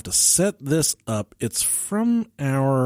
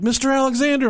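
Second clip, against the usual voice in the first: higher, singsong counter call; pharmacist calling out; 10 semitones higher than usual. Retold or imitated. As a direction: imitate a pharmacist summoning a customer. Pharmacist calls customer's name.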